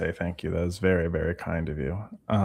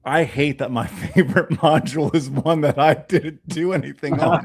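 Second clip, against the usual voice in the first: higher, laughing mock grievance; laughing mock complaint; 4 semitones higher, 4 dB louder than usual. Confessing ego to partner about favorite module, laughing protest.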